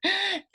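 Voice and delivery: loud, high intake of breath; exaggerated hurried breath between phrases; audible gasp. Shown as a demonstration. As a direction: demonstrate a conspicuous gulp of air. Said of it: "kayak ngambil nafasnya tuh".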